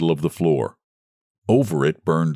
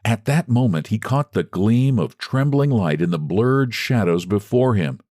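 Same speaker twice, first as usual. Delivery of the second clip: usual speaking voice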